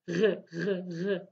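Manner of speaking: "r" scraped firmly at the back of the throat; a French-style "r", a little stronger. Shown as a demonstration. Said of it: "it's a little bit stronger".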